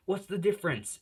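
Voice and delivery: high, pointed mock exasperation; exasperated retort. Bakugo arguing back at Izuku, high exasperated question. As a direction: challenge him sharply.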